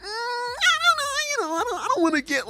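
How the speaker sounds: very high, wheedling hedge; hammed-up reluctant squeak; mock waffling. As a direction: squirm out of commitment up high. Acted waffling about commitment.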